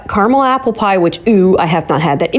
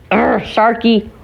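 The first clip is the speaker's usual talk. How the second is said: normal talking voice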